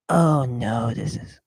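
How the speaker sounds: normal talking voice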